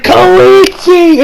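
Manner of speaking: high, loud, drawn-out cry of the name; acted shout. Retelling the episode's climax, high acted shout. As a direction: yell it out like an anime character.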